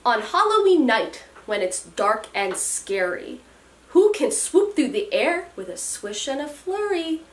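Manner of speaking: put-on lilting read-aloud to children; storybook reading. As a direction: read it like a bedtime rhyme. Reads picture book aloud.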